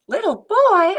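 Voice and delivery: high, loud glad greeting; exclaiming. Acted. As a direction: exclaim in welcome. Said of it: "Amu exclaimed".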